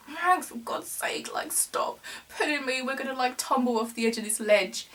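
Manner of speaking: high, hushed mock pleading; retold anxious plea. Telling Mags to stop pulling her near the ledge, anxious plea.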